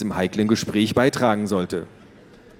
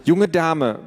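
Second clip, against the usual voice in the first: scolding mother; 7 semitones higher, 4 dB louder than usual. Acted.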